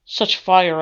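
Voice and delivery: usual speaking voice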